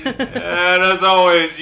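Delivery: laughing through the words; loud, high and slow while laughing. Real, not acted. Cracking up mid sign-off.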